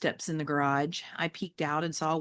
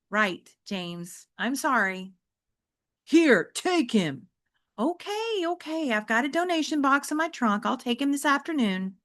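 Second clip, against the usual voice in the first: higher, gentle mother voice; placating mom; 7 semitones higher than usual. Acted. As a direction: humor the kid like a patient mom.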